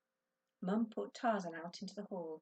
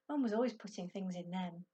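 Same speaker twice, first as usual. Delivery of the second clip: usual speaking voice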